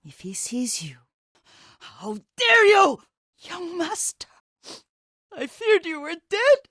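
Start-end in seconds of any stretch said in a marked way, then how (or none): 1.8-3.0 furious shout. Acted. Furious outburst at an intruder. 3.4-4.3 high, lifting "master" with a rise, full of relief; relieved surprise. Acted. Old servant relieved he lives. 5.4-6.6 emotional relief. Acted. Old servant relieved he lives.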